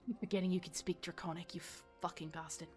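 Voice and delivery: ordinary talk, nothing marked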